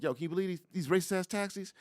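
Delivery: high-pitched, incredulous griping; imitated indignant man; ranting street guy. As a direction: buttonhole a stranger with a high-voiced rant. Retells man buttonholing passers-by about taxis.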